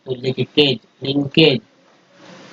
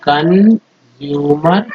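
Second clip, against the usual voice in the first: slow blended word; much slower than usual. Shown as a demonstration. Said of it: "यहाँ पे देखिए कन".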